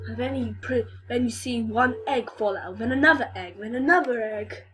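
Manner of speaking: ordinary talk, nothing marked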